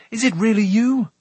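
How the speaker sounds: high, loud, eager question; amazed cry. A narrator's reading. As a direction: ask it in excited disbelief. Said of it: "Taran cried".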